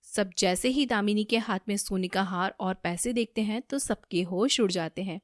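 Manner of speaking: plain reading voice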